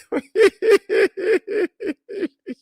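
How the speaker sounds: high burst of real laughter. Real, not acted. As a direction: crack up at the candy image. Laughs at his own joke.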